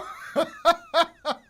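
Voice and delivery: high real laugh; loud laughing fit. Real, not acted. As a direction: crack up. Cracks up.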